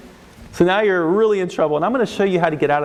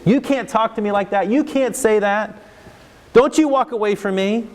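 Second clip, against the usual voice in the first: higher, indignant parental scolding; 5 semitones higher than usual. Acted. Parent ordering a kid who walks away, indignant. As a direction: demand it like an offended parent.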